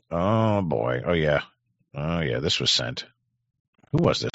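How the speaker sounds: usual speaking voice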